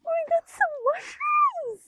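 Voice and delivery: high thrilled cry of delight; excited exclamation. Real, not acted. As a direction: burst out with joy at finding them. Finding mushrooms, excited exclaim.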